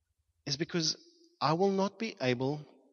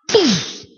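loud, high poof of a hand strike; cartoonish loud impact noise; imitated impact; 10 semitones higher, 12 dB louder than usual. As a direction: burst out the smashing sound.

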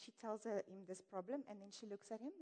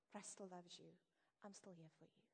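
quiet, gentle comfort; hushed, tender reassurance; soft reassurance; 3 semitones lower, 13 dB quieter than usual. Acted. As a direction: tell them softly they're still loved. Reassuring a struggling person.

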